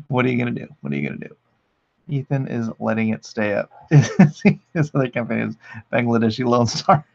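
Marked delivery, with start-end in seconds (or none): 0.8-1.4 much lower and softer; low quiet aside. Shown as a demonstration. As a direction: mutter it low under your breath. Says the line twice.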